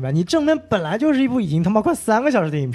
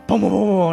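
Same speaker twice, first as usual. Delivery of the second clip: gunfire noises. Retold or imitated. Imitating gunfire.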